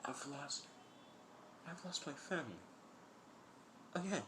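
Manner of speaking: quiet, high, grieving voice; hushed, halting realization of loss; tearful. Acted. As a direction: say it small and high, close to tears. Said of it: "with tears in his eyes".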